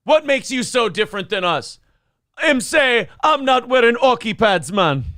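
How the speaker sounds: high-pitched Jamaican patter; Jamaican accent. Acted. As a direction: put on a comic Jamaican accent. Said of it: "Him's going to Jamaica".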